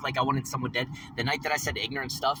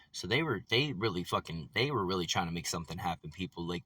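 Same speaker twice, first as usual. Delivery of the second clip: ordinary delivery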